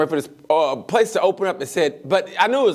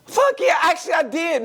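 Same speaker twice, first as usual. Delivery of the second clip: excited yell; 11 semitones higher than usual. Real, not acted. Eager answer about last night.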